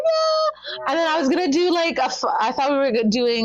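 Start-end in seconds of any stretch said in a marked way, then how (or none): 0.0-0.9 high, stretched excited yeah; thrilled yeah. Retold or imitated. Fan posing for a photo, gushing yeah. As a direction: let it ring with joy. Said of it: "I was like".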